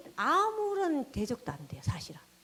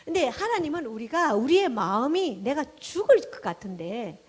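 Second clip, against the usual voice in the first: usual speaking voice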